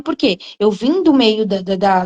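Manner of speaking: normal talking voice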